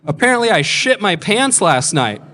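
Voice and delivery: re-enacted call for everyone's attention; loud announcement. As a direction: announce it brightly to the whole house. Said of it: "I stand up and I announce".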